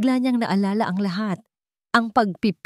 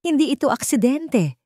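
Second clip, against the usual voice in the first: bitter, trembling cry; 8 semitones higher than usual. Acted. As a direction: throw the truth at him, voice shaking. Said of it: "Ang boses ay nanginginig ngunit puno ng kapaitan". (mapait at nanginginig na sigaw)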